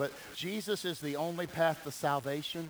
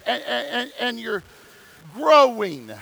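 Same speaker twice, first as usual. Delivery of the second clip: preaching at full force; 6 semitones higher, 11 dB louder than usual. Acted. Preaching opening build.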